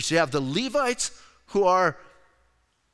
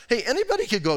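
normal talking voice

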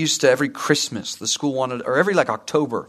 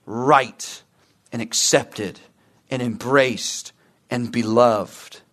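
God's tender verdict; 5 semitones lower, slower than usual. Retold or imitated. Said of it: "still says".

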